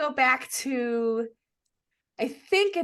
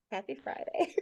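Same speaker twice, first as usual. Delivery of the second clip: subdued; 9 dB quieter than usual. Shown as a demonstration. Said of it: "Anyway, so".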